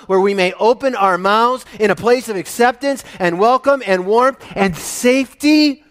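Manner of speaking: loud, fervent sermon voice; full-force preaching. Acted. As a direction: preach it out to the congregation.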